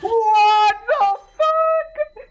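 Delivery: drawn-out, high, loud what the fuck; long disbelieving howl; stunned shout. Real, not acted. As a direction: yell it out slowly in disbelief. Stunned at a huge throw.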